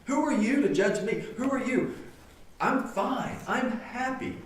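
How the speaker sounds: high-pitched indignant imitation; mock defensive protest. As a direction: act out an outcast brushing help away. Outcasts protesting, acted by preacher.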